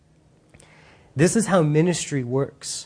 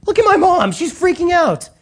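put-on much higher, louder whiny teen appeal; tattling teenager; 12 semitones higher, 5 dB louder than usual. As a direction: complain to God about mom like a kid. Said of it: "and he's like".

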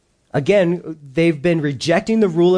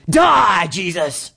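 mock defiant yell; much slower than usual. Preacher voicing a defiant heart, mock yell. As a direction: fling it at him like a rebel. Heart rejecting Jesus' rule.

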